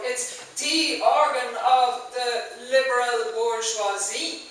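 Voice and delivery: slow, mockingly precise enunciation; over-enunciated. A narrator's reading. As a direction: pick out each word too clearly, like school French. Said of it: "Christie's words were enunciated too clearly".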